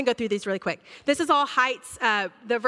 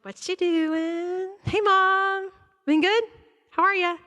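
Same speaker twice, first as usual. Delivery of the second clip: higher, breezy mock teenager acting like nothing happened; fake-casual teen; 8 semitones higher, slower than usual. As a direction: sidle up all sing-song and innocent. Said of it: "I'm like".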